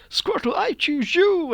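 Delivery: high, loud, drawn-out summons; hammed-up high battle cry; mock trainer battle call. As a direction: call out the monster like an overexcited hero.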